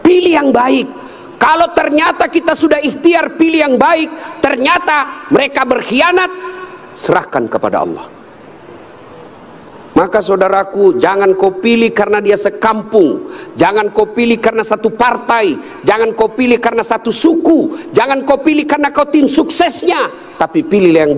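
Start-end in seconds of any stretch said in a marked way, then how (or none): 0.1-6.3 high-pitched exhortation; fervent preaching. Real, not acted. Preacher urges the crowd to choose well, fervent high exhortation. 10.0-20.1 repeated appeals climbing in pitch; rallying sermon. Real, not acted. Preacher warns brethren against biased voting, rising exhortation. Preacher lists warnings to voters.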